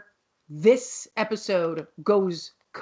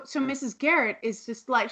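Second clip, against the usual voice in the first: ordinary talk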